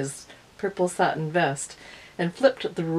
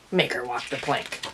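a low, gruff-edged pirate captain giving an order; lightly voiced pirate captain; 5 semitones lower than usual. A narrator's reading.

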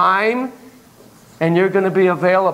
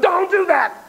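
high, sharp cry of warning; emphatic scolding; 12 semitones higher, slower than usual. Acted. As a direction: scold them in a raised, high pitch. Scolds students who would copy him.

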